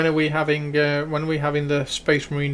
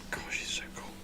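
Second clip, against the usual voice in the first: whispering; 17 dB quieter than usual. Real, not acted. Admiring the model under breath.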